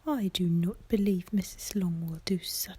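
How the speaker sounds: ordinary delivery, nothing marked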